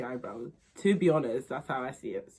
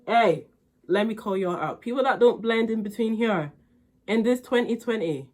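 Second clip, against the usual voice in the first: loud, high mock scolding; calling out; 4 semitones higher, 10 dB louder than usual. Real, not acted. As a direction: call them out, sassy and loud. Calls out viewers, scolding.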